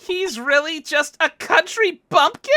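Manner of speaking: high and loud, drawn out in disbelief; stunned exclaim. Real, not acted. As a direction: gasp it out in shock. Shocked laughter at reveal.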